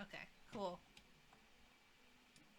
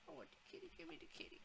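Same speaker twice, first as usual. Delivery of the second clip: unvoiced whispered craving; 6 semitones higher, 19 dB quieter, much faster than usual. Real, not acted. Gamer whispers longing for the kitties, a hushed whisper. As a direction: whisper it greedily to yourself.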